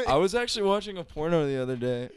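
high, loud announcement; odd delivery. Real, not acted. Speaker oddly announcing a porno to friends, high and loud. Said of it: "why I said it like that".

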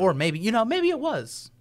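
loud, high-pitched mock suspicion; joking insinuation. Joking about the Ohio election, mock suspicion. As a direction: pitch leaps up on a teasing maybe. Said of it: "that was a joke".

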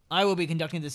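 normal talking voice

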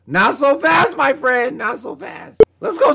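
a hint of the college football analyst catchphrase; Lee Corso impression. Host borrows a famous sports pundit's catchphrase, lightly voiced. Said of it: "like Lee Corso".

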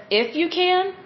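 "If you can?" rising high, questioning; incredulous question. Retold or imitated. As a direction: call him out with disbelief. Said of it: "Jesus calls him out on that".

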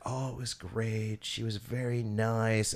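soft, slow drawn-out impression; imitating Ron's long talk. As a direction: stretch the words lazily like a creep. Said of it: "he talks really weird where he's like".

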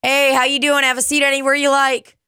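perky hostess patter; cheery server greeting. Retold or imitated. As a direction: wave them in with a chirpy welcome. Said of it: "I'll be like".

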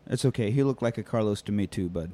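soft, low, fading comfort; consoling buddy. Retold or imitated. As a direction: ease it down to a soft low hush. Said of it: "Bradley Cooper's like".

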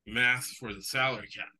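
ordinary talk, nothing marked

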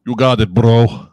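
loud, slow, hammed-up Russian accent; bad Russian accent. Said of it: "the bad Russian accent".

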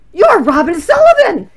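high, loud, laughing exclamation; excited recognition. Retold or imitated. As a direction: gush with delighted surprise. Stranger recognizes author excitedly.

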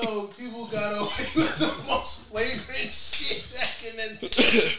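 high, soft, giggling words; stifled giggles. Real, not acted. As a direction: talk through a held-in laugh. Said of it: "I'm trying not to laugh".

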